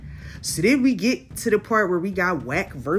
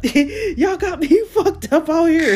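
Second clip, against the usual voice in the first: high, loud yelling that breaks into laughter; heated Hassan impression; 10 semitones higher, 3 dB louder than usual. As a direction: shout him down, then crack up laughing at the end. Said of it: "Hassan Campbell is like".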